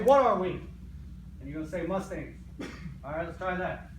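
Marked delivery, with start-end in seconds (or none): 0.0-0.6 loud team call. Shown as a demonstration. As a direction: call it out for an answer. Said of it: "And then I'm gonna say".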